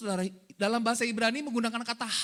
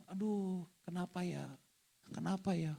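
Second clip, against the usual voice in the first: soft, lower, mock-mournful musing; mourner brooding; 3 semitones lower, 9 dB quieter than usual. Preacher acting a bereaved person brooding, quiet sorrowful murmur. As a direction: wonder it quietly to yourself, lightly imitating grief. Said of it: "Orang lagi berkabung tuh merenung".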